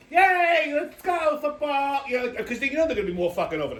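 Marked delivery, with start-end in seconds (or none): 0.1-2.0 high, chirpy hammed-up cheer; mock cheerleader. Comic mocking NFL cheerleaders, in a high, hammed-up mock cheer. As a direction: squeal it like an over-the-top male cheerleader, pitched high. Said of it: "this fucking pixie going".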